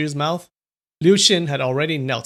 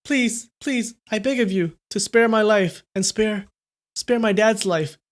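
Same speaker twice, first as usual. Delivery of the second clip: high-pitched, desperate begging; scared pleading; 8 semitones higher than usual. A narrator's reading. Scared boy begs for his and dad's life, pleading. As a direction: plead high and haltingly for mercy. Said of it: "was scared out of his wits".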